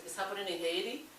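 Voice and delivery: ordinary delivery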